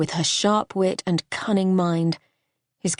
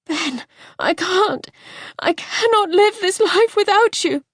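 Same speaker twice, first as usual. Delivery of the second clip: much higher, louder, tearful pleading; sobbing; 12 semitones higher, 5 dB louder than usual. Acted. Wife sobbing to her dying husband, tearful pleading. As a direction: break down as you beg. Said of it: "She started to cry ... great heaving sobs".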